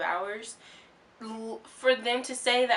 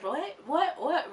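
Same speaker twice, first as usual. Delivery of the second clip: surprised; 7 semitones higher, slower than usual. Retold or imitated. Star's surprised reaction.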